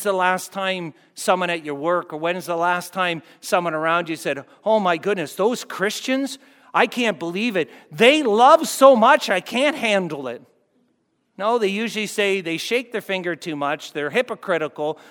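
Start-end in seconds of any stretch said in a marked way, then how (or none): 4.6-10.4 higher, louder, slowed amazed gushing; hammed-up amazed gush; mock amazed gush. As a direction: play a coworker stunned by love. Mock amazed coworker quote.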